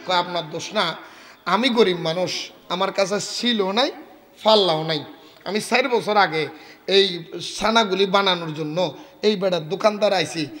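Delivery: usual speaking voice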